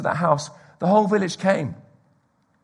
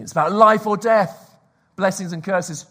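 ordinary talk, nothing marked

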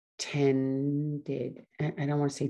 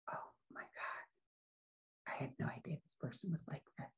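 very soft, breath-only realization; whispered dismay; 16 dB quieter than usual. Retold or imitated. Woman realizing what her new friend is like, whispered dismay. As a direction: whisper it, as if it is just dawning on you.

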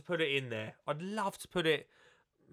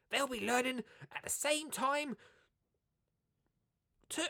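much higher put-on voice; Matt Biggs impression; 10 semitones higher, 3 dB quieter than usual. Reading the book blurb aloud, mimicking Matt Biggs, high put-on voice. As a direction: read it as mock Matt Biggs. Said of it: "I won't do the others in the voice".

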